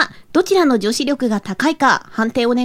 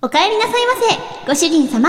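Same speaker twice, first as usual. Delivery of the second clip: high, sweet maid cafe welcome; sugary maid cafe greeting; 6 semitones higher than usual. Acted. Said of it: "かわいい。".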